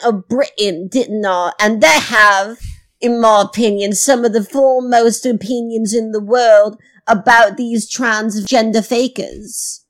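hammed-up fake British accent; put-on British accent. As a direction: welcome listeners in a badly faked British accent. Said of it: "is there something up with your voice?".

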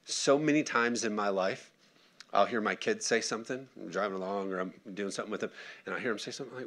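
normal talking voice

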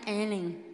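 mimicking ELLEN, low and falling; imitated intonation. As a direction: say Ellen low, as a mock self-introduction. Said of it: "Vocês viram a entonação".

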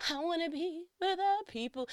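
high, dreamy Disney princess voice; sing-songy longing to be out among people; Little Mermaid Ariel. Retold or imitated. New mom mocking herself as Ariel, high and dreamy. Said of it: "I was Ariel".

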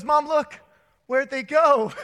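high, loud, through laughter; puzzled kid voiced while cracking up; laughing kid voice. Son puzzled, teller laughing.

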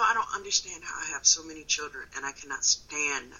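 ordinary talk, nothing marked

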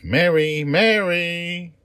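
high, loud searching calls; calling out. Acted. Child calls for lost friend.

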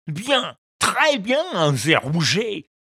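high, gloating fisherman voice; pleased fisherman. A narrator's reading. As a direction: savour every fish like a glutton. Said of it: "estima-t-il". (voix de pêcheur gourmand et ravi)

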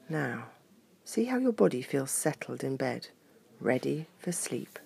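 coaxing relaxation guide voice; sleep story narrator. Acted. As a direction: lead a gentle body wiggle. Papa Bear guides relaxation.

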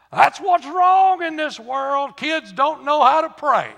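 loud, high, slow scandalized scolding; a pious old woman huffing in outrage; scolding old saint. Retold or imitated. Old saint rebuking the boy's ice cream prayer, loud and slow.

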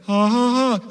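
high, loud, sustained "ha, ha, ha"; mock choral "ha". Visitor mimics what greets him walking into church, high mock chanting. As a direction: imitate a congregation intoning together. Imitates a church's unison sound.

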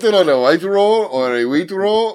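badly faked Malaysian accent, lilting; mock Malaysian accent. Clerk offering bread rolls, mock Malaysian accent. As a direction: list choices in a put-on Malaysian accent. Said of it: "Uh, Malaysian".

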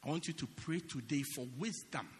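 ordinary talk, nothing marked

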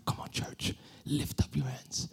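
soft breathy whispered call. Retold or imitated. Worship leader whispering to the congregation, softly. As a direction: whisper it gently to the church. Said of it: "Han viskar".

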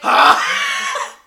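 sudden very high shriek; hammed-up shriek of fury; mock rage scream. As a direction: burst out with a high, loud scream. Imitates president snapping at nuke button.

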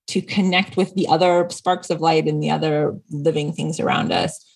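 ordinary talk

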